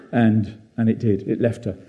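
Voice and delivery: ordinary talk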